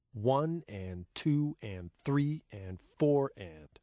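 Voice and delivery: slow, even counting of each beat; rhythmic counting. Shown as a demonstration. Teacher showing a class how to count eighths, slow and even. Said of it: "they are counted like this".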